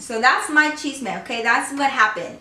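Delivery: usual speaking voice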